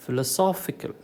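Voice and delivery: Philosophical as philo-SOPH-ical, slightly lifted at the end; stress on SOPH. Shown as a demonstration. Teacher repeating the rule, SOPH stressed in Philosophical. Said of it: "third syllable from the last".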